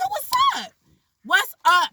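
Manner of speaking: high-pitched squaring-up challenge; hyped, squealed reenacted challenge; mock confrontation. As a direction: act out calling out an entourage, voice shooting up. Acting out squaring up to entourage.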